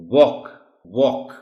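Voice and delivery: واک، واک with a long open aa sound; واک repeated slowly for children; pronunciation demo. Shown as a demonstration. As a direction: model واک twice, stretching the vowel. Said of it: "یہ جو آ sound ہے اس word میں".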